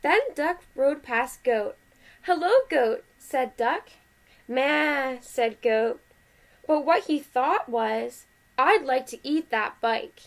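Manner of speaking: lively, measured picture-book reading; animated read-aloud with well-marked phrases; story read to children. A narrator's reading. Parent reading Duck on a Bike to kids, animated story voice.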